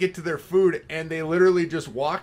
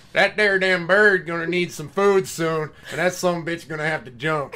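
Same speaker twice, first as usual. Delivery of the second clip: loud mock Tennessee country narrator; hammed-up Tennessee accent, building louder; 10 dB louder than usual. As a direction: imitate a Tennessee guy calling the action. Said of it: "some guy from, like, Tennessee".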